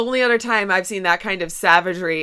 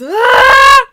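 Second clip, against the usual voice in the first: a big hammed-up karate yell; mock yell; 13 dB louder than usual. Retelling the movie, a mock battle cry. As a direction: shout the fake death blow. Retelling Miyagi's fake strike.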